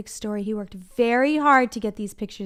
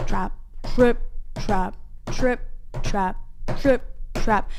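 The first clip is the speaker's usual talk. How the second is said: big trip trap. Shown as a demonstration. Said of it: "Give me some big ones".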